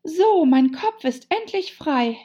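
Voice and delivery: high, loud cry of relief; delighted. A narrator's reading. Alice to herself as her head comes free, delighted. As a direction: call it out with joy. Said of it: "sagte Alice erfreut".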